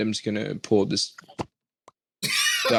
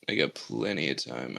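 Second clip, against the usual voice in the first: I got plenty of time said low and soft; quiet; 8 semitones lower, 9 dB quieter than usual. Pretend. Stalling while acid kicks in.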